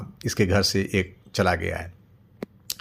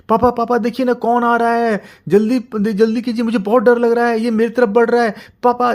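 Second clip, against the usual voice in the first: put-on high, loud, panicked child's cry for papa; a small child begging father to save him, screaming; terrified child; 13 semitones higher, 5 dB louder than usual. Child screaming for papa in terror.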